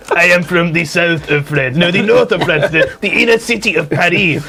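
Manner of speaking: tough-guy attempt at a French accent; hammed-up French accent in English; gruff French accent. Explaining his French origins to friends, gruff mock French accent. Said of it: "sound like Danny Trejo trying to do a French accent".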